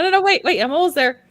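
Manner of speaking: high, loud and hurried; frantic. Real, not acted. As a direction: scramble to stop them, flustered. Frantic while computer won't cooperate.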